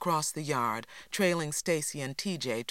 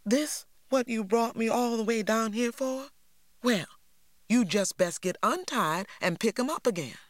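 Lily's voice; 8 semitones higher, 3 dB louder than usual. A narrator's reading. Lily indignant voice.

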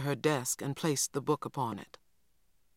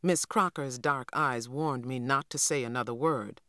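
plain reading voice

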